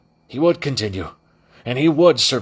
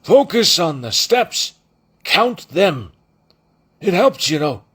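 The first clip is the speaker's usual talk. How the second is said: higher, louder muffled coaching; 8 semitones higher, 4 dB louder than usual. Acted. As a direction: coach him through a muffled wall. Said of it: "said the muffled voice".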